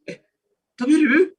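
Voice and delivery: shocked "Eh, taberu?" rising sharply; surprised question. Shown as a demonstration. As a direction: sound taken aback: "taberu?" jumping up high. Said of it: "itu kaget dia kok makan gitu ya".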